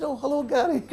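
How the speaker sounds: very high, laughing mock-friendly hello; squeaky-high fake cheer; feigned innocent greeting. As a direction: greet sweetly, as if nothing had happened. Feigned innocent greeting to famous caller.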